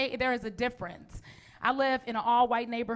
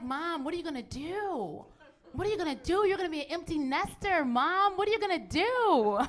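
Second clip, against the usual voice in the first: higher, whiny mock pleading; fretting daughters; 5 semitones higher than usual. Daughters asking Mom about empty nest, mock fretting. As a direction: imitate worried daughters pestering Mom.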